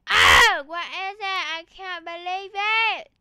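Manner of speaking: over-the-top loud disbelief; mock outraged disbelief. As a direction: burst out in theatrical shock. Mock outburst while playing.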